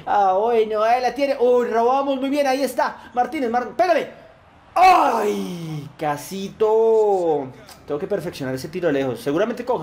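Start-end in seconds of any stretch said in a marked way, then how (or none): 0.1-4.1 raised, eager play-by-play; rising excitement as the attack builds; excited urging. Real, not acted. Urging players on during attack. 4.8-7.5 long, drawn-out "so close" cry; loud cry sinking into a slow lament; near-miss groan. Real, not acted.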